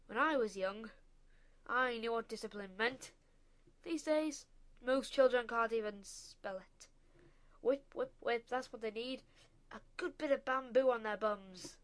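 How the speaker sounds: put-on loud, booming old-fashioned rant; blustering rant. A narrator's reading. Father ranting to his wife about discipline, loud bluster.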